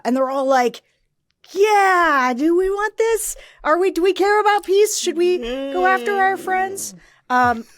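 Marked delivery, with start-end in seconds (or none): 1.5-6.9 higher, hammed-up mock follower voice; lightly mock the followers' fake concern; mocking MAGA follower. Mocking MAGA followers asking if they care about peace, in a higher mock voice.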